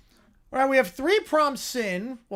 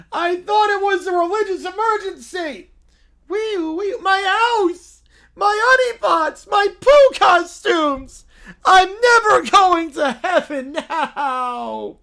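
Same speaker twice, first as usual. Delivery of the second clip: high, loud mock sobbing cub; little bear crying over the burned house; hammed-up wailing; 10 semitones higher, 10 dB louder, slower than usual. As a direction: wail over every lost thing. Bear wails over loss.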